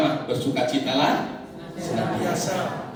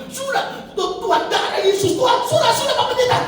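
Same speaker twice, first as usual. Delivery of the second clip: pleading calm-down; 14 semitones higher, 3 dB louder than usual. Retold or imitated. Pastor from Ambon pleading.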